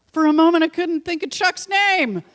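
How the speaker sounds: high, loud exclamation at own slip; self-mocking outburst. Real, not acted. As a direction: burst out over a blanked name. Exclaims over own memory lapse.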